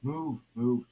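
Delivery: loud impatient grumble; grumbling. A narrator's reading. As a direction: shove through the crowd, barking it. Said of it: "shoving and grumbling".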